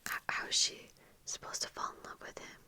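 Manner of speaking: ordinary delivery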